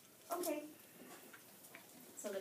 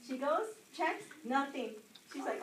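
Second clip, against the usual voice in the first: ordinary talk, nothing marked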